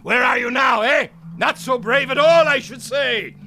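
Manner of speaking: mocking tirade. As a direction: taunt them like a camp officer. Captain taunting the dead prisoners.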